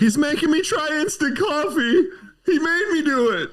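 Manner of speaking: high, laughing through the words; laughing complaint. Real, not acted. Telling the wife about the instant coffee, laughing. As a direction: whine in playful protest.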